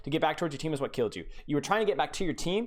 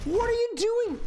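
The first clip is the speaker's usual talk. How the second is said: much higher, incredulous what are you doing; exasperated disbelief; slower than usual. Real, not acted. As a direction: shoot up in pitch at the blunder. Coach reacts to baffling play.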